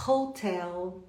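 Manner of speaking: "Hotel" in English with a strong breathy H; drawn-out English "Hotel", H aspirated; H pronounced. Shown as a demonstration. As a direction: sound the H of "Hotel" for learners to copy. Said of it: "você vai pronunciá-lo como H".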